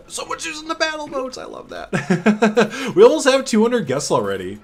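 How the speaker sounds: high, delighted and laughing; excited squeal. Real, not acted. Gleeful reaction to guests.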